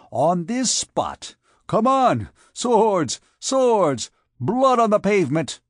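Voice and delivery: loud, high cries for a sword fight; enraged challenge. A narrator's reading. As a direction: shout him into a duel in rising fury. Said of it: "then stammering, for anger as well as fear".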